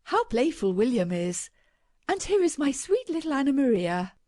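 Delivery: fondly. A narrator's reading. Mother praising her children to guests, fondly doting. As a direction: coo over the children fondly. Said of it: "she fondly observed".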